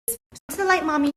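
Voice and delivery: high-pitched pet-calling voice; coaxing a dog. Real, not acted. Owner calling her dog into the light, high coaxing voice.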